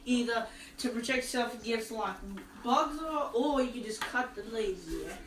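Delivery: put-on Aussie voice; Australian accent. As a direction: play along in mock Australian. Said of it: "do an Australian voice".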